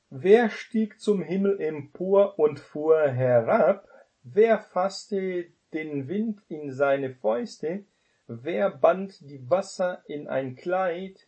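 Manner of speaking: measured Bible reading, each question lifted; solemn scripture reading. Acted. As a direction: read it out like Scripture from the pulpit. Reads Bible verse aloud.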